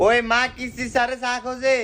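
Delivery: high-pitched shouted message; calling out. Acted. Child relaying the collector's tea request, calling out. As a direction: call it out high to mother.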